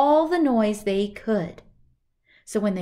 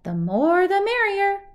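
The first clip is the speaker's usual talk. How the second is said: high, loud merry answer; cheerful; 11 semitones higher, 7 dB louder than usual. Acted. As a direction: sing it out merrily.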